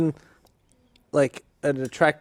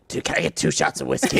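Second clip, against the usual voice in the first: much lower, laughing imitation of an older smoker; mock smoker voice; 10 semitones lower than usual. Mimicking his date ordering whiskey shots, low and laughing. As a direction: drop way down and play the boozy date, half laughing. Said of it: "she sounded like a sixty-year-old smoker".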